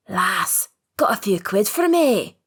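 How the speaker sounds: lightly voiced rasping Scottish man, rising on the ask; a hint of a rasp from a thin street man; rasping beggar. A narrator's reading. Beggar in the dark asks the girl for money, rasping. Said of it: "a rasping voice suddenly asks".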